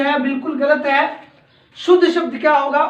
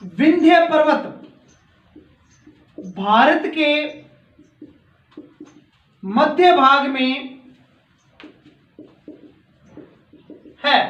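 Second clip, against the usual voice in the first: slow, word by word, with long gaps; teacher dictates a sentence for students to write down, slowly; slow dictation. Shown as a demonstration. Dictating each word slowly so pupils can copy it. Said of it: "ये जो वाक्य है note कर लीजिए".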